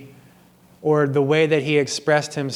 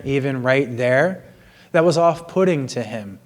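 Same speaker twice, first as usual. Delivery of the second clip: normal talking voice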